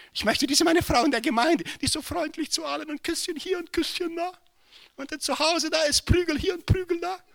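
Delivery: high, sing-song mocking complaint; a lilting, exaggerated grumble about his wife; imitating the brother. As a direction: play the henpecked husband's lament. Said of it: "Sagt er".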